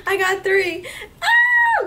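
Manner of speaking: a real shout of joy, high and loud; excited yelling over new makeup. Real, not acted. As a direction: squeal it out loud. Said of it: "I get a little loud and crazy".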